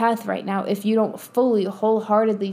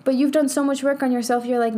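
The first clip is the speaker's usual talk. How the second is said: normal talking voice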